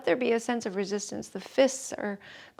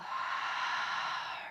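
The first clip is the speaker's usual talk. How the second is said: long, soft, voiceless sigh; demonstrated open-mouth exhale; 6 dB quieter than usual. Shown as a demonstration. Yoga teacher modelling the exhale for the class, audible sighing breath. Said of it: "open the mouth and exhale".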